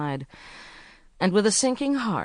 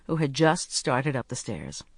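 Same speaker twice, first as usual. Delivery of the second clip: plain reading voice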